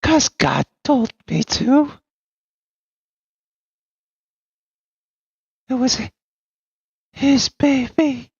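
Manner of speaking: high, slow words broken by mad laughter; maniacal laughing. Acted. Madwoman laughing madly at the party. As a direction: cackle through the confession. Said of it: "begins to laugh like a maniac".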